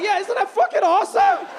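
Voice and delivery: very high, loud delighted yell; thrilled. Retold or imitated. Boyfriend proudly answering his confused girlfriend, thrilled. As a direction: boast it proudly.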